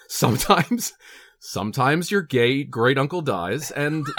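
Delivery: slow, laughing through the words. Real, not acted. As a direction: giggle while you say it.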